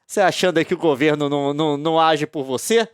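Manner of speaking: normal talking voice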